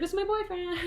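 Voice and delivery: high, soft put-on voice; high mock voice, announcing his boyfriend; mock Henry. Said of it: "he's like".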